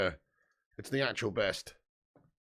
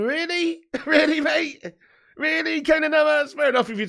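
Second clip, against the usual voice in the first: much higher, mock-disbelieving squeal; can't believe the haircut, squeak it; incredulous; 12 semitones higher, 6 dB louder than usual. Real, not acted. Mocks goalkeeper's bleached hair.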